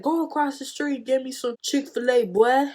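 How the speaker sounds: mock her bossy demand; bossy friend order. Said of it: "the way she said it".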